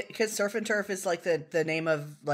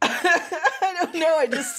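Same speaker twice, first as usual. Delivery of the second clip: laughing; 9 semitones higher, 3 dB louder, slower than usual. Real, not acted. Laughing, giving up.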